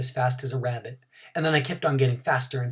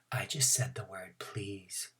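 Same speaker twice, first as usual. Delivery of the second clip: soft unvoiced whisper; 6 semitones lower, 14 dB quieter, slower than usual. Grace whispering the secret to Junie B, unvoiced. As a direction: breathe it like a secret. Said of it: "That Grace did a shrug".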